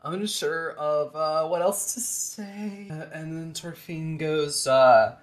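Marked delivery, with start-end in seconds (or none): 0.0-2.9 put-on awkward girl. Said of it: "It's like waving and is like".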